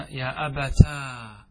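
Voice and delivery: slow, long final aa on أبتا; retained alif. Shown as a demonstration. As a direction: draw أبتا out to "abataaa". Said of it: "أثبت الألف".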